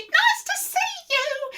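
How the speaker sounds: very high falsetto greeting; squeaky-high storybook hello; friendly hoot. A narrator's reading. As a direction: hoot a welcome like a duckbill. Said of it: "and they hooted".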